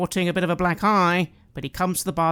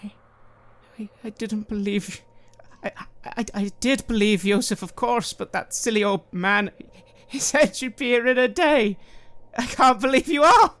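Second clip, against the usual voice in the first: overwhelmed, halting and stammering, higher; tearing up; 7 semitones higher, 4 dB louder than usual. Acted. Mother to her rescuer, tearing up a little. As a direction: start choked, then build to a raised cry of disbelief. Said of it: "begins to tear up a little".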